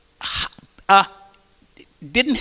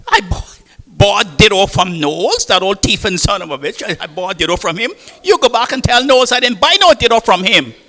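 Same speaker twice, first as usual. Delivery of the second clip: angry wife; 4 semitones higher, 6 dB louder than usual. Retold or imitated. Wife's outburst at her husband.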